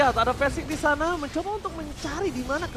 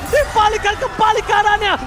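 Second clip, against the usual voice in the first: excited caster; 8 semitones higher, 6 dB louder than usual. Real, not acted. Caster hype call.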